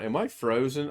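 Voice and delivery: ordinary talk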